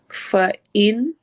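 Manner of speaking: فَإِن with a clear ringing noon, rising at the end; slow careful Quran teacher demo of فَإِن; tajweed recitation. Shown as a demonstration. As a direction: say فَإِن with the fa light, not like wa, and the noon held clear. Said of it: "نون کی آواز clear ہوگی".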